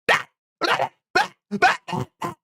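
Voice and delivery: silly babbling noise rising high; mock annoying babble. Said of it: "they're like".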